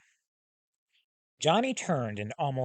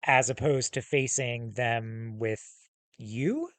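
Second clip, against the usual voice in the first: usual speaking voice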